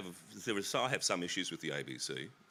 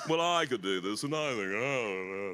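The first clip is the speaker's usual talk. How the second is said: mock Ian Sinclair; 3 semitones higher, 5 dB louder than usual. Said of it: "I sound like Ian Sinclair".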